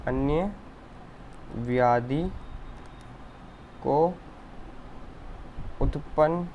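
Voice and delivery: word by word, long pauses between each; slow dictation. Shown as a demonstration. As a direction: dictate slowly as if students write it down. Dictates the shloka meaning slowly.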